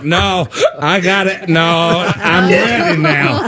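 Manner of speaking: slow, dragged-out insistence; stubborn boyfriend. Retold or imitated. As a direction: drawl it like a stubborn guy.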